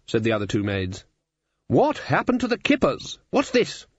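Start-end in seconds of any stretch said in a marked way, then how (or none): 1.7-3.9 very high-pitched, affronted questions; indignant surprise. A narrator's reading. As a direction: demand it in comic outrage. Ridcully outraged at his breakfast.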